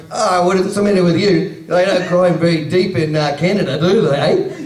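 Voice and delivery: big, pushy Aussie voice from the back; Aussie heckler. Acted. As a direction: heckle loudly in a put-on Australian accent. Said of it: "I got the Aussies in the back going".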